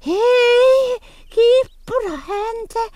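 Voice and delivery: high, drawn-out call to the cat. Acted. Calling out to the curly-tailed cat, high and drawn out.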